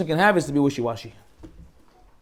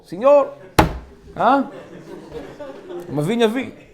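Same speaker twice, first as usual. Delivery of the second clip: higher-pitched mock Spanish accent; mock Spanish-speaking laborer; 4 semitones higher, 3 dB quieter than usual. Rabbi imitating a day laborer for laughs, mock Spanish accent. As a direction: call out in a joking Spanish accent. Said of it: "one of the guys you pick up from Home Depot".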